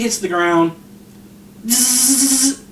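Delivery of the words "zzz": higher-pitched sustained zapping noise; vocal electrocution sound effect; electric buzz. Retold or imitated. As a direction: imitate an electric shock with a buzz. Imitates TV electrocuting the body.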